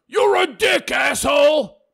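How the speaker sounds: high, loud, hammed-up insult; mock angry troll. Host reading a troll's insult aloud, mock angry shout. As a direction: shout it like an outraged commenter.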